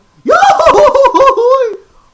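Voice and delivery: very high, very loud cartoon yell; Goofy holler. Showing off his Goofy voice, huge cartoon holler. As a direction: let the goofy scream rip. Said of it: "I got the scream down".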